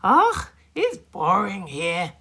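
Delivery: high loud bored moan. A narrator's reading. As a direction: whine about being bored. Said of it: "moaned Archie".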